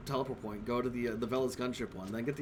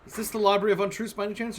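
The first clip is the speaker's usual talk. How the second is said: eager; 7 semitones higher, 6 dB louder than usual. Acted. Player reads own line aloud.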